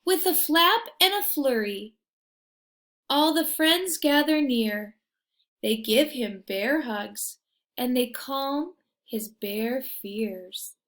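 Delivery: lively storybook reading; reading to children. A narrator's reading. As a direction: read it like a bedtime picture book. Reading picture book aloud.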